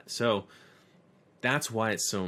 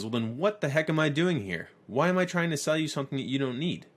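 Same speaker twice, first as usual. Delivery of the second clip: higher and louder, quick and cocky; indignant comeback; 7 semitones higher, 3 dB louder, faster than usual. Retold or imitated. Veteran salesman throws the pen back at Jordan, cocky and loud. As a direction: toss it back like a smug veteran.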